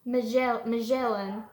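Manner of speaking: put-on storybook read-aloud for little kids; reading to children. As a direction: read it like story time for a class. Teacher reads picture book aloud to kids.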